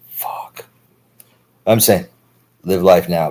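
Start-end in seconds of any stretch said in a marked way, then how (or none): 0.1-0.7 breathy unvoiced whisper of dismay; whispered curse. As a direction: whisper it under your breath, stunned. Dismayed aside about time passing.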